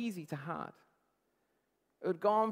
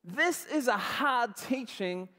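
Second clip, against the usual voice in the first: slow, measured reading of the verse; scripture reading; 6 semitones higher, 4 dB louder, slower than usual. Shown as a demonstration. Preacher reading scripture to the congregation, slow and measured. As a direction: read the verse slowly as the text. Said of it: "it says".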